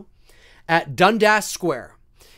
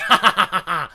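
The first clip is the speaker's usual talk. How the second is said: real staccato belly laugh. Real, not acted. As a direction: burst of amused cackling. Laughs at his McRage nickname.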